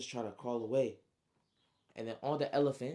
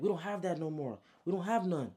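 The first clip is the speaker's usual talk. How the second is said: higher, urgent denial; urgent protest; 6 semitones higher, 4 dB louder than usual. Acted. Romanji answering the man demanding dollars, urgent higher-pitched protest. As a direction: protest as if cornered.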